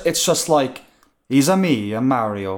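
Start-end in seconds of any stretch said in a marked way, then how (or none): 1.3-2.6 lazy cartoon Italian, barely put on; mock Mario catchphrase, half-hearted; half-assed Mario accent. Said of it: "anybody in the world doing a half-assed, arsed Mario accent".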